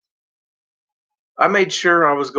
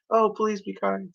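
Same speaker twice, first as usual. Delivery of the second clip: sugary kind voice; 7 semitones higher, 8 dB quieter than usual. Retold or imitated. Mock sweet contrast after rage.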